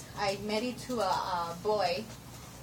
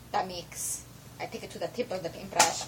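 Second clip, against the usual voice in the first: usual speaking voice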